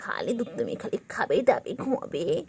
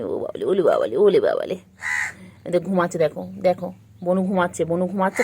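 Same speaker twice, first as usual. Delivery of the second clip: loud cooing; 3 semitones lower, 7 dB louder than usual. Real, not acted.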